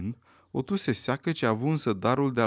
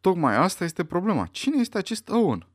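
brusque interruption, pitched high; abrupt; 7 semitones higher, 4 dB louder than usual. A narrator's reading. Emily Brand interrupts the General, abruptly. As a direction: cut in suddenly with a pointed question. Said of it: "îl întrerupse zicând brusc".

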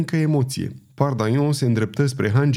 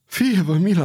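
a gasping, higher cry for mercy; rattling plea; 6 semitones higher than usual. A narrator's reading. Half-drowned ferryman gasps for mercy after surfacing. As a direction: beg while choking on water. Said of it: "horcăi".

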